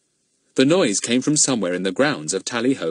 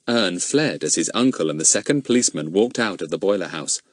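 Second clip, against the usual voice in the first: usual speaking voice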